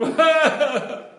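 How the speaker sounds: high, loud yelp, imitated; imitated little cry. Girl crying out as her neck moves, imitated high yelp. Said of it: "she would do this little cry".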